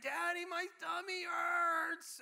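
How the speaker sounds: high, drawn-out mock wail; whining toddler. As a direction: whine to dad like a sick little one. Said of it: "my second child".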